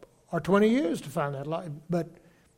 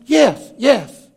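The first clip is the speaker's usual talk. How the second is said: high and loud insistence; emphatic yes; 7 semitones higher, 11 dB louder than usual. Retold or imitated. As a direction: blurt it out, loud. Retold, answering board member.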